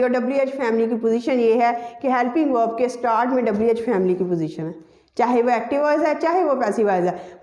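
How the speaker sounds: usual speaking voice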